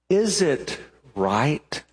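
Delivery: very slow, deliberate question; slow probing question. Acted. God earnestly asking Jonah. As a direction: weigh each word of the question. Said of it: "It could have been".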